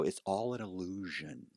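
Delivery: slow, soft mysterious reveal; hushed reveal. Retold or imitated. Teller revealing the fake to the agent, hushed. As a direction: let it out slowly, like a magician.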